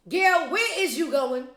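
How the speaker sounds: high, loud, incredulous question; emphatic call-out. Pretend. Vlogger calling out to Gayle about space, loud emphatic demand. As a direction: holler it at her, punching every word. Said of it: "for more emphasis".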